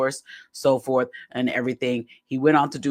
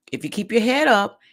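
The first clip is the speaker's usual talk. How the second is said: ordinary delivery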